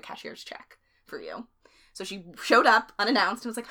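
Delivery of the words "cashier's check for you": soft, whispered sly promise; hushed Anna. Retold or imitated. Friend voicing Anna's whispered promise. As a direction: breathe it like a secret.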